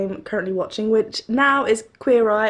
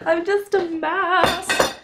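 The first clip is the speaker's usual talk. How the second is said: slow, laughing self-mockery; real laugh; 8 semitones higher, much slower than usual. Real, not acted. Laughing at herself for crying at the show. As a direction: laugh the words out.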